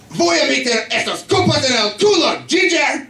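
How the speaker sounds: very high mock-threatening Spanish; Spanish-speaking coworker.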